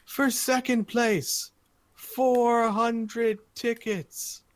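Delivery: high, slow, drawn-out prize announcement; arena announcer. Acted. Announcer revealing top prizes to the arena, grand build-up. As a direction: build up each prize like a showman. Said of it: "he says as the audience".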